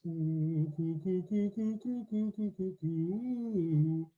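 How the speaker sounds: round, hollow throat on each coo; long hollow coo. Shown as a demonstration. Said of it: "that sense of length and hollowness in the throat".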